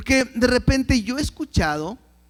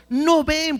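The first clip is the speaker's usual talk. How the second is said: usual speaking voice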